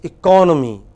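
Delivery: eCONomy with a loud, falling CON; stress on second syllable. Shown as a demonstration. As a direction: demonstrate "economy" with weight on CON. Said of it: "just listen".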